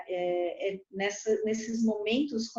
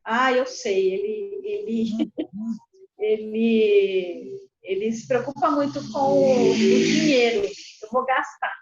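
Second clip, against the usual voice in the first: louder, eager burst of inspiration; excited idea; 7 dB louder than usual. Retold or imitated. The wife tells the counselor her revenge plan, eagerly and louder. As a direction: blurt out the plan, delighted. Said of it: "Aí ela falou".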